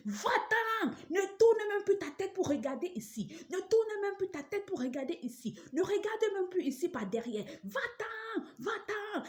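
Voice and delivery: very high shrieking chase-off; imitated screaming. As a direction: screech her away from the house. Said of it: "elle a commencé à crier". (hurlements aigus imités)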